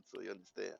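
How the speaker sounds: normal talking voice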